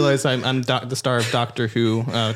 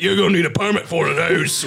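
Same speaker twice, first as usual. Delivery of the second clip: London driver; 5 semitones higher than usual. Acted. Trolley driver objecting to finger guns, mock Cockney. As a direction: scold in hammed-up Cockney.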